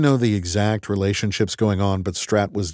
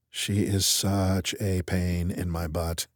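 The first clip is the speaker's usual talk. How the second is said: mumbling; 2 semitones lower, 6 dB quieter, slower than usual. Acted. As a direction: mutter it slowly to yourself. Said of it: "I mumbled".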